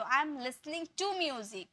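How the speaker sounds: slow careful model sentence; teacher demo, each word spaced out; slow correct model. Shown as a demonstration. Teacher repeats the correct sentence slowly for the class. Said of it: "तो".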